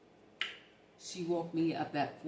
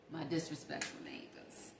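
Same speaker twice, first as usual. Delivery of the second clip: breathy whispered confession, much softer; whispered aside; 3 semitones lower, 12 dB quieter than usual. Real, not acted. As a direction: drop to a whisper like a secret. Muttered confession aside, unvoiced.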